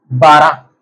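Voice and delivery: 'बारह' said the Swedish way for the class; 'बारह' with a clean open first a; Swedish word. Shown as a demonstration. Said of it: "Swedish जुबान में कहते हैं बारह".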